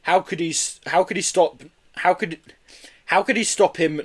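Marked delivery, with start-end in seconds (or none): none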